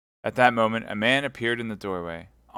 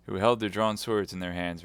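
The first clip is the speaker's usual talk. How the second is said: ordinary delivery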